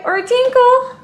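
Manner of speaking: usual speaking voice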